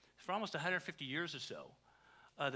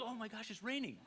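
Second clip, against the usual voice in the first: mock alarm; 6 semitones higher, 4 dB quieter than usual. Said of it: "all of us are going".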